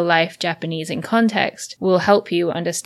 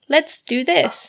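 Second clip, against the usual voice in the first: extra polite sign voice; 8 semitones higher, much slower than usual. Retold or imitated. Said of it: "they'll be like".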